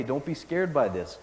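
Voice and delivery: ordinary talk, nothing marked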